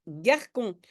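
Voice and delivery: "garcon" said with a hard K, gar-KON; wrong pronunciation. Shown as a demonstration. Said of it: "sinon je dois lire garcon".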